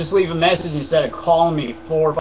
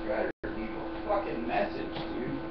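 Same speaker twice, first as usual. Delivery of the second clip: hushed annoyed mutter under breath; 11 dB quieter than usual. Real, not acted. Annoyed at a caller, muttered under breath. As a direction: grumble it almost voiceless.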